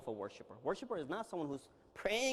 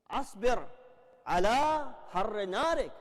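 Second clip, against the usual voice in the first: recited supplication; 5 semitones higher, 5 dB louder than usual. Real, not acted. Recites Dua Kumail Arabic.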